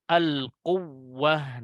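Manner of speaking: al-quwwah very slow, doubled wawu held; correct stress. Shown as a demonstration. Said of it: "itu yang benar ya".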